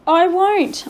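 high, choked defiance. A narrator's reading. Desperate boy defies the headmaster, choking it out. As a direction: refuse loudly, close to tears. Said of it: "Omri choked out".

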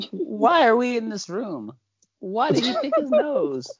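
lightly voiced confused trainee. Mocking the character's confused look to co-hosts, mock bewilderment. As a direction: puzzle over every question. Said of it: "she always looks confused".

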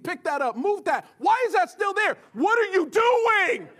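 barking orders, loud and high; drill sergeant. Retold or imitated. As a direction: bark commands at the kids like a drill sergeant. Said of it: "walking around like a drill sergeant".

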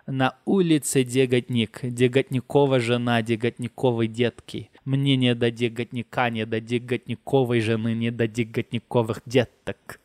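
rhythmic recital with shifting stressed syllables; Russian tongue twister. Shown as a demonstration. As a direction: rattle through the Russian "Деготник" forms, bouncing the stress. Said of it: "um trava-línguas que a gente fazia muito".

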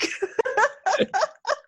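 high, loud delighted laugh; real loud laughter; big laugh. Real, not acted. Laughs at the accent showing.

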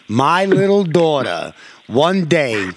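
ordinary delivery

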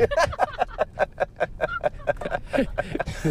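high wheezing laugh; breathless laughter. Real, not acted. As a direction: silent shaking laugh. Teller bursts out laughing.

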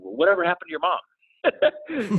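high, drawn-out mock question; exaggerated high, slow voice of nosy listeners; imitated curious followers. Voices nosy social media messages.

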